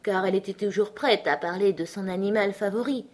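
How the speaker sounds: usual speaking voice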